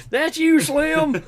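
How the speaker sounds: high, loud excited call; mock announcer. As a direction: cheer him on like a rodeo announcer. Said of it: "I'm like".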